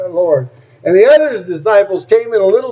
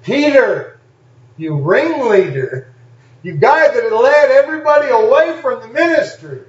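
raised, higher-pitched accusing call; single him out as the preacher voicing Jesus; calling Peter out; 7 semitones higher than usual.

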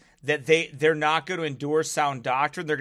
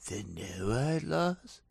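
slow, low, dazed stoner; drawn-out stoner wonder; 3 semitones lower, 5 dB quieter, slower than usual. Acted. Mocking conspiracy believers as a dazed stoner. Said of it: "I know I sound like a stoner".

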